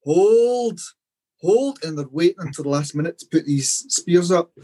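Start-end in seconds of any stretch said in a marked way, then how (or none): 0.0-1.8 drawn-out battle command, climbing higher each time; captain's shout. Retold or imitated. Fan retelling Braveheart's captain ordering troops to wait, imitated rising shout. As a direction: imitate a captain holding the line, rising pitch. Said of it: "one of the captains shouting".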